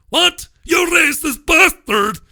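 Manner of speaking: high, loud hammed-up indignant yell; outraged shout. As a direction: squeal it like a hammed-up offended bigot-hunter. Mocking an offended complainer.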